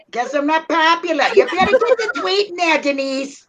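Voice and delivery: high, nagging Bronx voice; mock-offended Bronx accent; New York Bronx accent. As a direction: nag in put-on New York. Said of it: "don't you like my New York Bronx accent".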